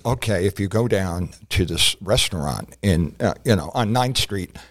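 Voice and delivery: low-voiced wink-wink tip-off from a fixer; fixer's advice. A fixer tips off the comic about the restaurant, low and knowing. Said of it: "a certain person told me".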